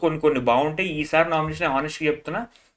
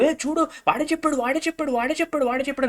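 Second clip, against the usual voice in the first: high, mocking imitation of a contestant, much higher than his voice; Tanuja mimicry; 10 semitones higher, faster than usual. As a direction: squeak it out like a sulky accuser pointing at someone. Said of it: "అని నెట్టేస్తుంది". (ఎత్తైన గొంతుతో వెక్కిరిస్తూ అనుకరణ)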